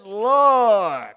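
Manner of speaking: very loud, drawn-out call. Acted. Peter calling on Jesus, loud and drawn out. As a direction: holler it out, falling at the end.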